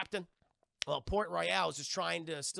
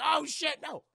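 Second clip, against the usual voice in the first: much higher, louder dismay; panicked cry; 10 semitones higher, 6 dB louder than usual. Retold or imitated. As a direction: gasp it out in horror. She panics aboard ship.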